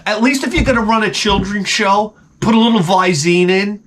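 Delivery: ordinary talk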